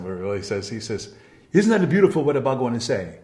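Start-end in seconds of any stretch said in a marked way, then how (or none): 1.5-3.1 louder, higher, rough attempt at Italian; half-attempted Italian accent. Italian man to friends after a lecture, lightly attempted Italian accent. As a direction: exclaim admiringly in a half-hearted Italian accent. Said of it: "I can't do an Italian accent".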